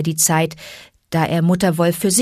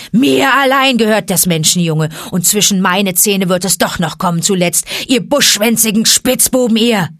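loud, higher roared threat; roaring tiger; 4 semitones higher, 6 dB louder than usual. Acted. Shere Khan roaring at the wolves as he leaves. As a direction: roar back from outside the den. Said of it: "brüllte".

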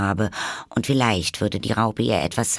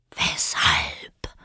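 caterpillar; 4 semitones lower than usual. A narrator's reading. The caterpillar coolly contradicts Alice in a whisper. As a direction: dismiss her in a low whisper.

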